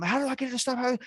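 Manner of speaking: high-pitched panicked questioning; frantic retold panic, pitch way up; panicking. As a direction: blurt it out in a rising mock panic. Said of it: "so I'm panicking".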